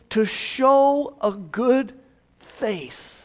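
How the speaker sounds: slow, deliberate word by word; slow literal rendering. Shown as a demonstration. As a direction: stretch out each word of the translation. Literal translation spelled out slowly.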